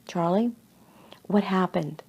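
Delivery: soft, measured question at normal pitch; calm questioning. A narrator's reading. Fireman questions Charlie after the fall, calm and soft. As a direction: ask it gently and quietly.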